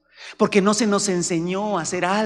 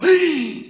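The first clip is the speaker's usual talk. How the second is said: shocked gasp; 11 semitones higher, 6 dB louder than usual. Acted. Shocked reaction to confession.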